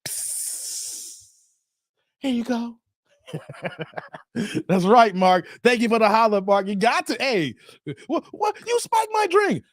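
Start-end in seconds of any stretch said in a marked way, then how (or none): 0.1-1.3 soft voiceless hiss; sneaky hissed sound; spiking-the-drink sound effect. Retold or imitated. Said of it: "go over there and be like". 8.1-9.6 very high squeaky-pitched disbelief; hammed-up high startled voice; mock shocked woman. Acts out the woman's shocked reaction.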